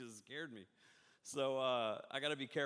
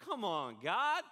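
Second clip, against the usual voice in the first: exasperated cry; 4 semitones higher, 6 dB louder, slower than usual. Acted. Mock complaint to God.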